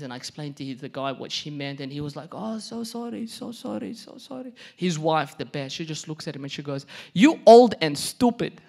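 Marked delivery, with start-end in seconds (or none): 2.3-4.5 high, softening repeated apology; profuse apology. Retold or imitated. Grocery packer apologizing to mom, high meek apology. Man apologizes after the explanation. 7.1-8.6 loud, slow, high put-down; scornful insult. Retold or imitated. Wife looks at husband, loud mock scorn.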